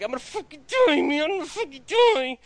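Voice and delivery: a mock panicked wounded man; imitating Tim Roth. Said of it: "Harvey Keitel from Reservoir Dogs".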